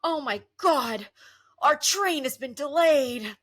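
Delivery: mock panicked boomer; fussy boomers. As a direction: whine it like a panicked traveller. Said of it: "I just hear these boomers go".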